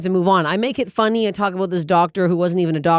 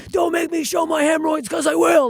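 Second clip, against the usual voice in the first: much higher, brash and daring; defiant stage bit; 10 semitones higher than usual. Retold or imitated. Comic on stage daring the crowd, mock brash. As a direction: dare the crowd, half teasing. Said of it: "I'm on stage being like".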